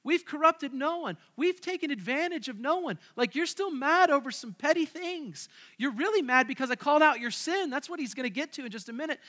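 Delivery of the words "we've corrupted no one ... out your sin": defensive Paul. Retold or imitated. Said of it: "He says".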